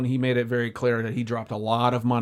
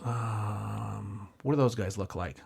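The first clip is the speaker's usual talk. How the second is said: lower, softer sly question; creepy low voice; 6 semitones lower, 9 dB quieter, much slower than usual. Retold or imitated. Client eyeing kids from car, low creepy question. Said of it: "And he goes".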